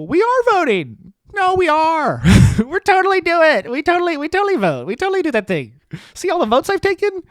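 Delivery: high, insistent mocking voice of congressmen; squeaky defensive politician act. Congressmen defending themselves to a taxpayer, high mocking voice. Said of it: "they stand there and go".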